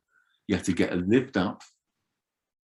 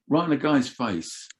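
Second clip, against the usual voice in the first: normal talking voice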